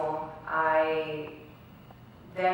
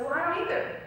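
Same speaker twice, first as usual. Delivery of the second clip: higher, louder surprised reply; a friend's surprising confession, voiced higher; 12 semitones higher, 4 dB louder, faster than usual. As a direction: friend blurting out a shared secret.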